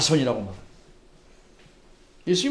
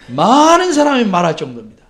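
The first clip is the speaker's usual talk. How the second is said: usual speaking voice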